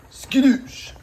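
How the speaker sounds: silly high yelp as the garlic goes in; comic exclamation. Pretend. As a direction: throw it out like a cartoon sound effect. Comic sound as garlic drops.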